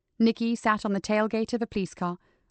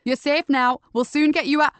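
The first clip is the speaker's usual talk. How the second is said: loud, high call into the dark; 5 semitones higher, 6 dB louder than usual. Acted. Nicky calling to the captive inside the rubble. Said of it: "calling out".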